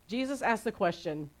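ordinary delivery

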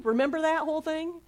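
ordinary talk, nothing marked

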